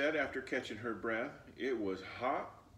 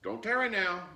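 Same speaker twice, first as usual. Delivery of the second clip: higher, louder call; calling after her; 11 semitones higher, 7 dB louder than usual. Acted. Grandmother calling after Flossie, raised voice. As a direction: call after the child as she leaves. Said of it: "Big Mama called".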